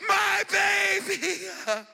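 put-on slow, high, loud wailing cry of my baby; weeping mother. Hannah leaving her son at the temple, wailing slowly. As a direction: wail it out slowly like a mother losing her child.

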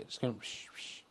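soft breathy whoosh noise; whoosh sound. Pretend. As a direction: blow out a soft ghostly whoosh. Ghost whooshing sound effect.

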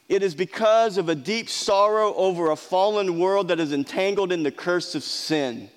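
normal talking voice

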